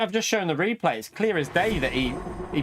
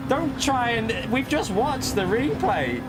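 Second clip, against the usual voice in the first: slow exasperated; 6 semitones higher, slower than usual. Real, not acted. Arguing with Robin over replay.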